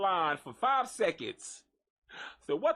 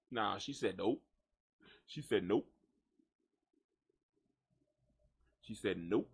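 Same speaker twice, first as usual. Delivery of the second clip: lower, softer mock answer; 8 semitones lower, 5 dB quieter than usual. Relaying the cat's refusal, lower and softer. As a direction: drop low and soft for nope.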